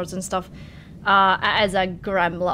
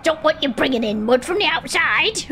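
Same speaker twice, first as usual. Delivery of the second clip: fussy wife; 6 semitones higher than usual. Acted. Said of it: "She's like".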